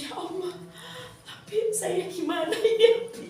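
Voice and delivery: high-pitched mock tearful appeal; retold tearful plea. Teacher appealing helplessly to the revered teacher, retold tearful plea. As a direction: plead as if overwhelmed, half laughing. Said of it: "udah senang nangis. Saya gini".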